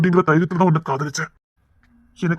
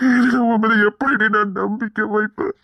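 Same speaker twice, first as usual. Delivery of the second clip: tearful pleading; 4 semitones higher than usual. Acted. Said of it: "எதுக்கு அழுறீங்க?".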